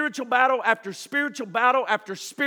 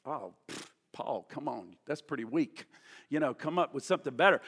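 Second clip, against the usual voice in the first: quiet scoffing pfft, unimpressed; dismissive pfft; 9 semitones lower, 9 dB quieter than usual. Retold or imitated. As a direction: brush it off as weak. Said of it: "we read it and think".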